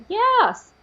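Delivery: high acted yes; emphatic agreement. Woman agreeing they are like vermin, emphatic agreement.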